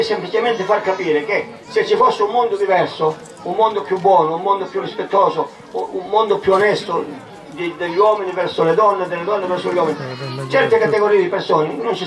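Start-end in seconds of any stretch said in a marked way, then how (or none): none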